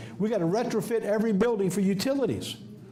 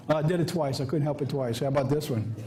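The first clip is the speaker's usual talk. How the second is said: ordinary talk, nothing marked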